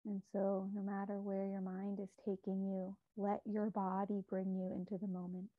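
calm, measured meditation guide with long pauses; unhurried, soothing meditation voice; guided meditation. Real, not acted. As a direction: guide listeners gently inward, leaving space between phrases.

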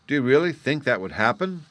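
gasped question. A narrator's reading. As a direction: ask in understated shock. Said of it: "he gasped".

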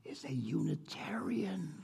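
hushed gossiping whisper. Acted. Emily tells her friend a secret, whispered. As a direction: breathe it like a scandalous secret. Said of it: "and lowered her voice".

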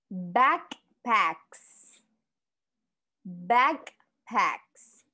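Backpacks with a crisp final s; model pronunciation. Shown as a demonstration. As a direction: say Backpacks clearly for the class. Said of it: "Como pueden ver y pueden escuchar". (pronuncia Backpacks con la s final clara)

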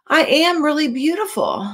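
misread line. Acted. Reading Rainbow Fish's line, then correcting herself. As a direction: slip the words around. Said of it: "Or no".

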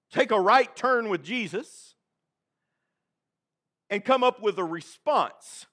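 normal talking voice

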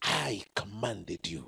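"I" drawn out, low and soft; slow, hushed weight on "I" before "commanded"; stress on I. Shown as a demonstration. Preacher shows the congregation God's emphasis, slow stress on "I". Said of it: "the emphasis is".

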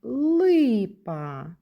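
ЛИПА drawn out on the first syllable; sounded-out word. Shown as a demonstration. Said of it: "І в слові".

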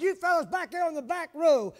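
loud, high-pitched rebuke; a preacher scolding the troublemakers; righteous indignation. Retold or imitated. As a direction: call out the back row with holy anger. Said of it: "a righteous indignation".

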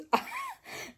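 sudden high-pitched burst of laughter; real delighted laugh. Real, not acted. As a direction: crack up at the joke. Laughing at a joke.

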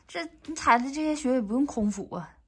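ordinary talk, nothing marked

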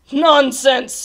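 loud scoff; snorting dismissal. A narrator's reading. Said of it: "snorted the captain".

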